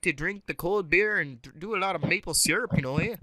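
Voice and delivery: lilting, hammed-up Canadian accent; mock-Canadian with tagged-on eh. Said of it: "starting to sound Canadian".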